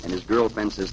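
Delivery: ordinary delivery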